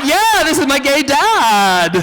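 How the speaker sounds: high, slow sassy reply; sweet southern shade. Retold or imitated. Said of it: "And she's like".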